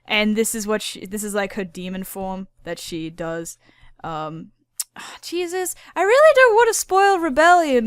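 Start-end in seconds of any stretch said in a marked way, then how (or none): 5.2-7.9 high and loud exasperated burst; excited outburst. Real, not acted. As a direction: blurt it out, worked up. Excited outburst about spoilers.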